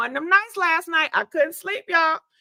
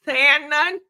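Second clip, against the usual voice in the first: ordinary delivery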